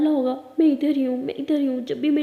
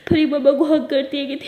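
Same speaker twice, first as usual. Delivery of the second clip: ordinary delivery, nothing marked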